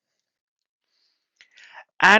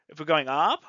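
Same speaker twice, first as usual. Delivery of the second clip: ordinary delivery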